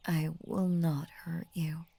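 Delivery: ordinary delivery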